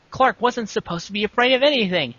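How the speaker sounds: ordinary talk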